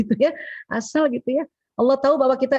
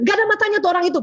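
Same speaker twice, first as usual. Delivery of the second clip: annoyed husband; 7 semitones higher, much faster than usual. Retold or imitated. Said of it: "Kalau suami bilang".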